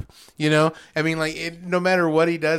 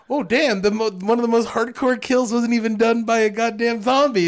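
excited retold reaction; 7 semitones higher, 5 dB louder than usual. Retold reaction to shocking kill.

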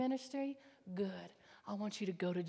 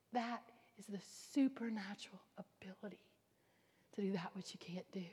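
hushed whisper; 8 dB quieter than usual. Real, not acted. Whispered sermon point.

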